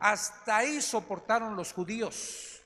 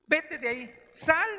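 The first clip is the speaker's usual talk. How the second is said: urgent command; 6 semitones higher, 6 dB louder, slower than usual. Retold or imitated. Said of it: "casi, casi le está diciendo".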